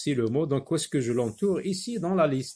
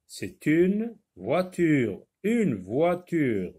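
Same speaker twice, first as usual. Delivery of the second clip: drawn out, each word clear; slow, careful reading voice; slow teacher model; 2 semitones higher, slower than usual. Shown as a demonstration. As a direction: say "voiture" slowly for a class. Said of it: "tu vois, wa".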